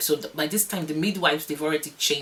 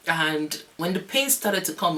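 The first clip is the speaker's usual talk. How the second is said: ordinary talk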